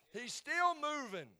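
high, loud, drawn-out preacher's cry; roaring pulpit shout; shouted proclamation. Real, not acted. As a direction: belt it out slowly as a climax. Climax of shouted Easter proclamation.

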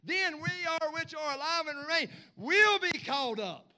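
loud, high, driving preacher's shout; fiery preacher, loud and high, drawn out; preaching at full force. Acted. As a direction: build the sermon to a roar.

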